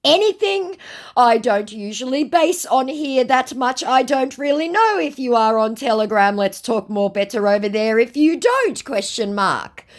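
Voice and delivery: high put-on voice reading broken English; mock scammer voice. Woman reading the scammer's garbled Telegram offer, in a high mock voice. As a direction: make the garbled offer sound silly. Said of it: "came the ridiculous response".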